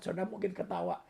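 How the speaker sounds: ordinary delivery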